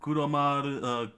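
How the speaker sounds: high, loud, self-satisfied king; gloating cheer. Acted. King gloating to his people after a feast, gloating cheer. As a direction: crow it out to your subjects. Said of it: "with a big cheer".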